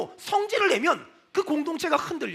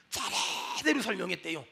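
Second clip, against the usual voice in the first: hushed emphasis; 8 semitones lower, 9 dB quieter than usual. Shown as a demonstration. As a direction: drop to a hush and stretch 차례대로. Hushed repeat of key word. (낮고 조용하게 차례대로의 '차'를 길게 늘여)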